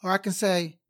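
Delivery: ordinary delivery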